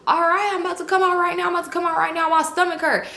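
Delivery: high-pitched hurried excuse; pleading excuse. Retold or imitated. [name] stalls inside the bathroom, high pleading imitation.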